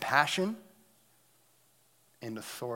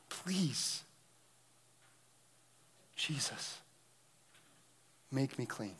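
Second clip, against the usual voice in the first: eager then hushed plea. Acted. Leper's plea voiced excitedly.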